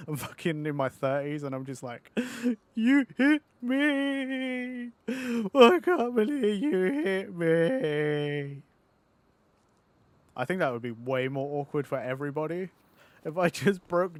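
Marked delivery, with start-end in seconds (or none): 2.8-8.6 high, slow mock sobbing wail; fake crying. Grown man fakes sobbing to a friend's mum. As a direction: wail it like a hurt child faking tears. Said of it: "that fake, like, crying".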